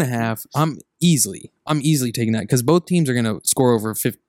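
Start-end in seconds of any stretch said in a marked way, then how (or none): none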